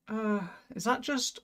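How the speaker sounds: ordinary talk, nothing marked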